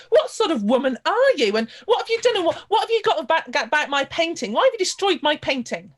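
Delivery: a painter aghast his work is ruined; outraged shout. Acted. Artist sees his gull fly off.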